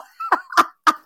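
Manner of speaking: quick high burst of laughter. Real, not acted. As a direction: let out a bright squeal of a laugh. Laughing after greeting Anna.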